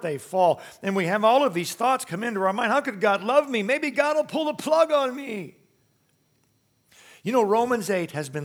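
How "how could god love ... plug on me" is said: anxious inner voice. Retold or imitated. Preacher voicing a believer's fearful doubt, higher pitch. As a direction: pitch rising into a worried, higher plea.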